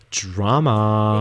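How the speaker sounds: slow, theatrical drawl on drama; hammed-up, drawn-out reveal; mock dramatic. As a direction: stretch the word out for effect. Running gag, stretched dramatic word.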